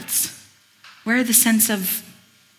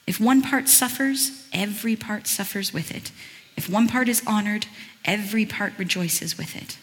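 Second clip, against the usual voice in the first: usual speaking voice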